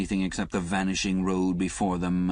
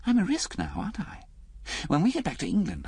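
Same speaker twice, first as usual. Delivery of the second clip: lightly voiced woman, higher; a hint of a wounded young woman, higher; Liz's voice; 9 semitones higher than usual. Reader voicing Liz to Alec, lightly higher.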